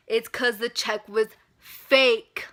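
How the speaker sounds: slow, loud reveal; slow punchline. Real, not acted. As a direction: drop the news slowly and heavily. Punchline of the bank visit.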